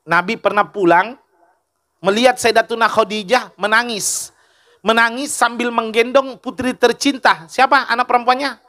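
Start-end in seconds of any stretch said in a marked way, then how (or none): none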